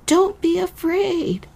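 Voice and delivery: high, slow, soothing reassurance; gentle reassurance. Acted. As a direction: coax a scared child gently. Man gently reassures the girl.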